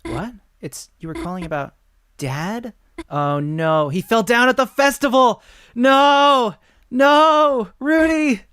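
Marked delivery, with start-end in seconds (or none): 3.1-8.4 high, loud cries of alarm; frantic shouts of no; panicked shouting. Acted. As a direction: yell it out in panic. Character panics about fall.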